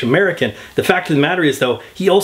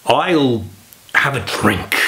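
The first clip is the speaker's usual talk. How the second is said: Christopher Walken impression; slower than usual. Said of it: "let's try it one more time".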